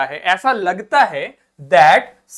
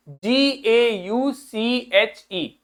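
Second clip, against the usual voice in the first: very slow letter by letter dictation; spelling out slowly; 3 dB quieter, much slower than usual. Shown as a demonstration. Teacher dictating the spelling for students to write. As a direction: spell it out so they can write it down. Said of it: "क्या लिखेंगे?".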